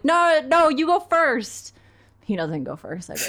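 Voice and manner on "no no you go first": high, slow, loud sing-song; trying to hide the wet pants; overly polite nervous push; nervous insistence. Retold or imitated. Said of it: "I'm like".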